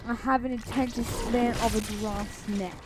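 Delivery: ordinary talk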